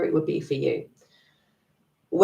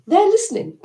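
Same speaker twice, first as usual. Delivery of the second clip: higher, louder mock realisation; surprised inner voice; 10 semitones higher, 6 dB louder than usual. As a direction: inner voice amazed it is finally heard. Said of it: "Your inner voice is gonna go".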